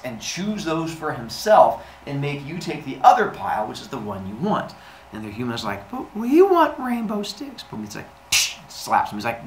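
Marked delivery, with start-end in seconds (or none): 5.9-7.6 put-on high, slow, childish whine; hammed-up pitched-up pleading; whining humans. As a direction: plead like a disappointed kid.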